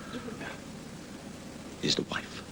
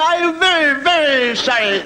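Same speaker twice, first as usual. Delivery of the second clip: high wailing very sorry; hammed-up tearful drunk; mock weepy drunk; slower than usual. Drunk wailing apology at confession, mock weepy.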